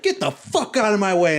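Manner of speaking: mock-angry demand without full volume; upset demand. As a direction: act out pushing through a crowd, fed up. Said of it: "I was so upset".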